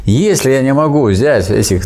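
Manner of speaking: normal talking voice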